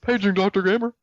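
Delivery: high mock intercom voice; hospital page. Paging Doctor Gamer in a joke, high mock announcer. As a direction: call it out like a hospital operator.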